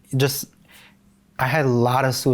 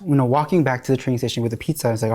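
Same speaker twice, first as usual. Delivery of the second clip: usual speaking voice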